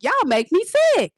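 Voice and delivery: high, teasing "y'all make me sick"; playful mock disgust. Friend teasing a cute couple, mock disgusted. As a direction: tease the lovebirds with fake disgust. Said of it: "I'm like".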